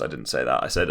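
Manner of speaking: ordinary talk, nothing marked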